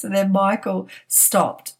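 normal talking voice

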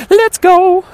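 very high, loud rallying cry; excited shout. Real, not acted. Vlogger signing off to followers back home, excited shout. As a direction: whoop it out at full volume.

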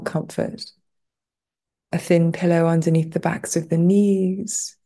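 unhurried meditation guide with long pauses; guided meditation. Real, not acted. Teacher guiding listeners lying on the floor through a body scan, guided meditation. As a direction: ease them into rest, leaving space between phrases.

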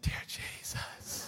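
whispered, slow, under the breath; panicking inside while smiling outside, slow whisper; inner panic. Retold or imitated. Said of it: "on the inside, I'm like".

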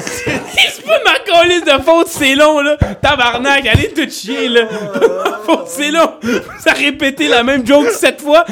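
high, loud squeal of protest; laughing outraged protest. Real, not acted. Defending himself after friends' repeated joke, real laughing exasperation. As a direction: swear back at them while cracking up. Protests teasing while cracking up.

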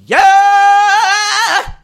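loud, high held yeah; joyful yell. Real, not acted. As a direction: shout it out at sunrise. Joyful wake-up shout.

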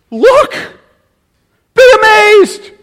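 very high and loud cry; astonished command. Shown as a demonstration. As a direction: shout it like a wonder you can't contain. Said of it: "with an imperative inflection".

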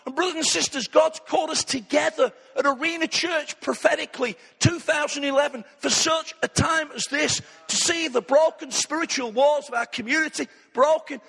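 rally the church against discouragement; rousing preaching. Real, not acted. Pastor rallying brothers and sisters at Arena Church, fervent preaching.

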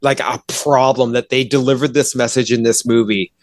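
usual speaking voice

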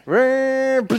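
high, loud imitated engine roar; one big rev with your voice; engine vroom. Motor revving before breaking.

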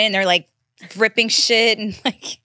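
usual speaking voice

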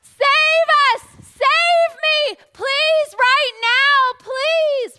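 very loud, high pleading shout; loud desperate begging; desperate cry. Acted. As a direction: scream for rescue, right now. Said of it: "it's a".

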